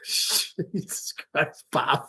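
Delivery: breathless slow laugh through words. Real, not acted. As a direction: laugh it out, barely voiced. Laughing at the crayfish.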